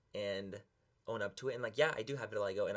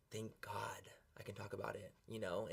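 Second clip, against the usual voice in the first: softer, breathy whispered relief; relieved whisper; 8 dB quieter than usual. Real, not acted. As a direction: whisper it as a weight comes off. Relieved confession after loud outburst.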